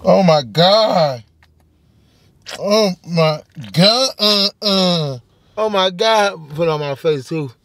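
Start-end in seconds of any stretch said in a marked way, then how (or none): none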